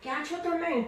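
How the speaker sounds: high-pitched call through the house. Acted. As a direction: call out to find someone at home. Calling for someone in the house.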